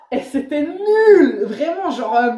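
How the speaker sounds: high, drawn-out laughing words; helplessly amused, slow and high. Real, not acted. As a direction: laugh through the self-mockery. Laughing at own silly answer.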